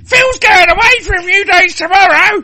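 very high, loud hammed-up squeal; excited shriek. Woman gushing to a friend about Phil, excited shriek. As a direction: shriek it at full pitch.

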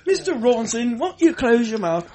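high mock dentist voice; character voice. Mocking a dentist's patient.

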